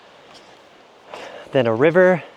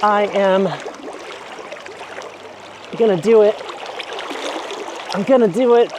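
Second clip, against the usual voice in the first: psyching up; 6 semitones higher than usual. Real, not acted. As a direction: hype yourself up to plunge. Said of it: "psyching up before cold dip".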